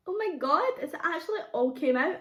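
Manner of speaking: high, thrilled squeal of delight; overjoyed at the result; excited. Real, not acted. Cake came out of tin.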